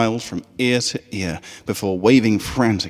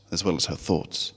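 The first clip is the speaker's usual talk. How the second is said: usual speaking voice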